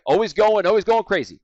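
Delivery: loud, high, hyped-up salesman; high and crazy. Shown as a demonstration. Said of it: "high and crazy people that I sound like".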